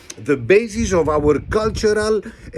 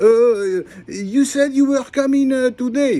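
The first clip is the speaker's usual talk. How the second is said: mock complaining buyer; 8 semitones higher than usual.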